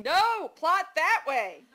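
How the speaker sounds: high, loud, slow mock-toddler command; imitation toddler yell. Writer ordering her characters about, mock toddler yell. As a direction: holler it like a bossy two-year-old. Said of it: "I feel like saying".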